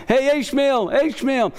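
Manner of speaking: a mother calling her son in for dinner. Retold or imitated. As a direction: sing out his name across the camp to bring him in.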